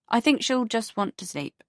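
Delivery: plain reading voice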